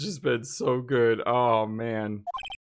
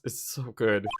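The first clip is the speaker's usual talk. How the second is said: ordinary talk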